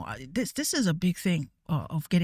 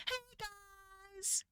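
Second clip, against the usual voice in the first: mock YouTuber greeting, much higher and breathy-soft; YouTube accent; 13 semitones higher, 19 dB quieter than usual. Streamer mocks the YouTube accent, high and soft. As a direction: imitate a bubbly YouTuber intro. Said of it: "The, the YouTube accent".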